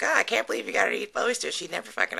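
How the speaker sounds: put-on loud, fast, high whining boyfriend; whiny boyfriend. Boyfriend complaining as she eats the oyster, loud and whiny. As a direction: complain indignantly about the oyster. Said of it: "boyfriend's sitting right there like".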